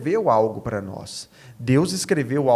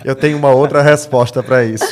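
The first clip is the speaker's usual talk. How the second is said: talking through real laughter, louder and quick; laughing; 13 dB louder, faster than usual. Real, not acted. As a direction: say it while laughing at yourself. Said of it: "eu sou pastor".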